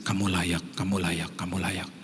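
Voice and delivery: like a voice ringing in your ears at night; echoing in head. Retold or imitated. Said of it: "suara itu terngiang-ngiang loh di telinga saya". (bisikan yang terngiang-ngiang, makin pelan)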